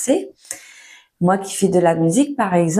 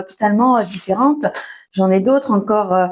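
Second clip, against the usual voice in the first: ordinary delivery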